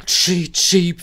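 high, loud imitated train toot. A narrator's reading. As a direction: blast it like a big engine's whistle. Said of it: "pfiff Henry". (hohes, lautes Lokpfeifen)

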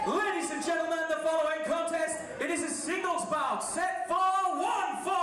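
slow, drawn-out ring announcer call; grand entrance announcement. Acted. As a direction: stretch every word like a ring announcer. Ring announcer introduces debut wrestler.

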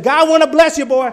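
a loud cry of blessing; mock blessing shout. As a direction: bellow the blessing as he slaps. Preacher voiced slapping healing.

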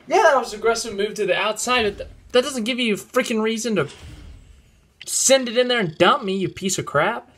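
angry rant. Real, not acted. Ranting at rival after crash.

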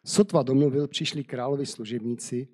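steady storyteller's reading voice; measured Bible story narration. Acted. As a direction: read the tale aloud to listeners. Reads Esther story aloud.

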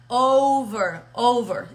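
"Over" with a clearly buzzed V; careful teacher's "Over", voiced V; vibrating English V. Shown as a demonstration. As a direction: model "Over" twice, teeth on lip for the V. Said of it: "la vamos a meter la vibración".

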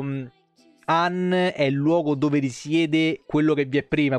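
usual speaking voice

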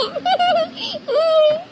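long high giggle; happy giggle. Acted. Child pleased by aunt's pet name, giggling. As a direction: giggle with teary relief.

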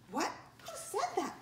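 high, puzzled little voice; startled child. A narrator's reading. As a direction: ask in sudden bewilderment.

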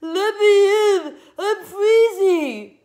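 very high, loud wailing yell. A narrator's reading. Girl shut out at back door, wailing yell. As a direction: wail it slowly like a dying hyena.

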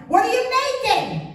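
startled mock cry. Cat kneading on his face.

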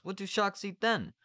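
put-on high child's voice; high-pitched curious question; questioning boy. A narrator's reading. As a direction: ask it in a high little-boy voice. Boy questions shark diet.

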